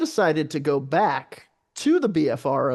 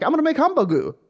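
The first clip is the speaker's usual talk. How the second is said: much higher-pitched mock eager quote; acted quote; 10 semitones higher, faster than usual. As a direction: pitch it way up, imitating someone. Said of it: "when you say that to somebody".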